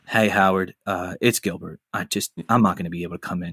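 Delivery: low, quiet, mumbling sick call; a hint of a groggy man calling in sick; Gilbert's real voice. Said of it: "it's his real voice".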